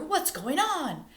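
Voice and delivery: high, loud, slow alarmed question; startled dad's shout. Acted. Father shouting to find out what's going on. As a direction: call out to find what's wrong. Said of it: "shouted Stella's father".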